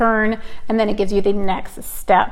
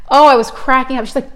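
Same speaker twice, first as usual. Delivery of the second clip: normal talking voice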